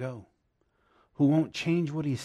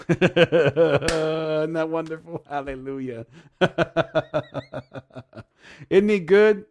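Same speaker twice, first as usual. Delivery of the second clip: laughing and exclaiming, slow then louder; joyful laughing; 4 semitones higher, 8 dB louder, slower than usual. Real, not acted. Preacher laughs with joy to the congregation after the prayer, delighted laughter. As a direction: bubble over with delighted laughter.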